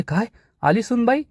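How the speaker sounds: high-pitched startled question; shocked. A narrator's reading. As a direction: ask it in shocked disbelief, pitch jumping up. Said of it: "Sulu आणि दत्त्याला जबर धक्काच बसतो". (धक्का बसून, आश्चर्याने)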